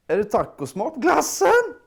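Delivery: sentence breaking into a rooster squeak; voice crack. Shown as a demonstration. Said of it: "make that gargling sound in your throat, like this".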